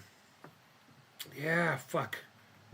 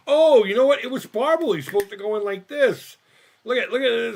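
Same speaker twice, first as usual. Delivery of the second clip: much higher, louder excited outburst; 8 semitones higher, 9 dB louder than usual. Real, not acted. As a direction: burst out in surprise. Surprised at the broken part.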